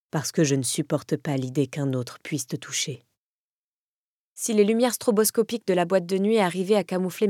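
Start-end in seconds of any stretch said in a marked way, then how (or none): 0.1-3.0 Kaz's voice, lower and hoarse. A narrator's reading. Kaz confessing his jealousy to her, lower and hoarse. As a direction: rasp it out, possessive and close. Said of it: "d'une voix rauque".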